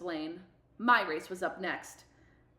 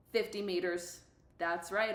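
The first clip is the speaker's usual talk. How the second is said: ordinary delivery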